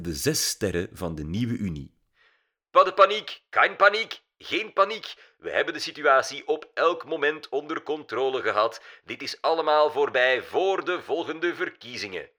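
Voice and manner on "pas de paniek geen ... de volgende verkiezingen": high, emphatic political address; loud public reassurance in short bursts; prime minister. A narrator's reading. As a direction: proclaim it to the nation, insistent. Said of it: "sprak de eerste minister".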